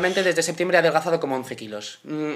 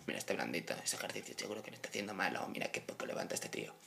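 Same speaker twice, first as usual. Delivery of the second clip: much softer, whispered sneer of a gym guy; mocking gym bro; 9 semitones lower, 21 dB quieter than usual. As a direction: sneer under your breath like a judging gym bro. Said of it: "te miran mal en el gimnasio". (susurro burlón de tío del gimnasio)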